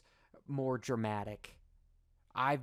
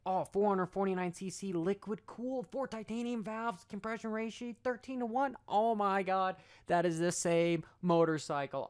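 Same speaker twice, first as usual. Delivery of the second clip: high, mock-thrilled reading of specs; sarcastic excitement; 6 semitones higher than usual. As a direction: fake awe at every number. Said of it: "that I'm being sarcastic".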